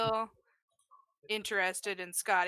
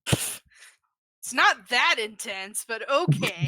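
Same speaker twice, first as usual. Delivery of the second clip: slow, drawn-out, louder deadpan protest; dry protest; 3 semitones higher, 7 dB louder, much slower than usual. Real, not acted. Player drawling back at the GM's joke. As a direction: stretch it out, half-joking.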